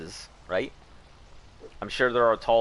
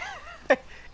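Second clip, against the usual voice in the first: squeaky laugh. Shown as a demonstration. Demonstrates his laugh.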